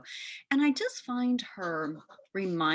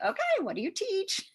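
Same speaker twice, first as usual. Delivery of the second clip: self-mocking; 13 semitones higher than usual. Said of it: "And I was like".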